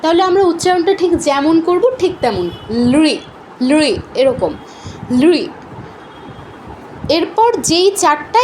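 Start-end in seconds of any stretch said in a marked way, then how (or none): 2.9-3.9 লুই as one syllable "lri"; l joined to ri. Shown as a demonstration. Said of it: "লয়ের সাথে যদি রিকার থাকে". 5.2-5.4 লুই as one short "lri"; l joined to ri. Shown as a demonstration. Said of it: "লয়ের সাথে যদি রিকার থাকে".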